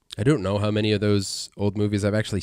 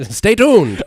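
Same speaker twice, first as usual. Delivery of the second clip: put-on high, loud old-timey voice; twenties voice; 10 semitones higher, 10 dB louder than usual. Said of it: "uh, twenties voice".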